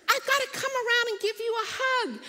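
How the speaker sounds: very high, loud adoring squeal; gushing cashier. Retold or imitated. Cashier greeting the daughter, high loud adoring gush. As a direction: gush over her like a star. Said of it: "And she goes".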